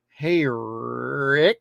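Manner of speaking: cocky, pretentious drawl; Negan. Retold or imitated. Negan taunting Sheriff Rick, cocky and pretentious. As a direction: draw the name out with a cocky rise. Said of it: "cocky, pretentious voice".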